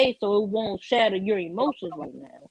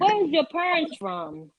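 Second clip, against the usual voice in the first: patient voice; 8 semitones higher, slower than usual. Acted. Patient's question in poem.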